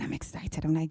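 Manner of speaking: ordinary delivery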